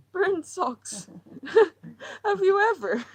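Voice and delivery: high, loud, laughing through words; giggling. Acted. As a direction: break into helpless giggles. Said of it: "She began to giggle".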